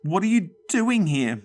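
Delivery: high, frightened broken question; choked out. Acted. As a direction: force it out past the fear. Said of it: "Mum finally choked out".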